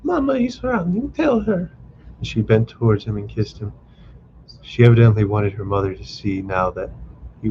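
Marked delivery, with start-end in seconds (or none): 0.0-1.7 high, light request; young wife. A narrator's reading. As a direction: ask him lightly, pitched high. Liza to husband.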